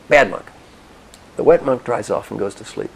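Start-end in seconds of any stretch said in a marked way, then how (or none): 0.1-0.5 loud, punchy mock reproach; louder, indignant telling-off; angry scolding. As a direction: throw it out as an acted accusation. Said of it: "is angry".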